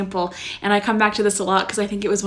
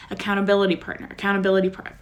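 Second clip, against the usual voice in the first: quick tongue-twister repeat; much faster than usual. Shown as a demonstration. Host proving she can say "accountability partner", quick tongue-twister repeat. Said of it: "Can you say that five times fast".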